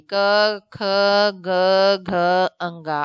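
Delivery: each letter said clearly from the throat: "क", "ख", "ग", "घ"; throat consonants demo. Shown as a demonstration. Teacher to class, sounding out the throat letters "क ख ग घ" one by one. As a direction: sound out "क" through "अंगा" slowly, letter by letter. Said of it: "आप ध्यान दीजिए बोलने में भी".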